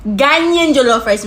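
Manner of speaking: "Ghanaian" punched loud; loud emphasis. Shown as a demonstration. Vlogger insisting it's Ghanaian jollof, loud emphasis. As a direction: hit "Ghanaian" hard and loud. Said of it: "we gotta put the emphasis on that".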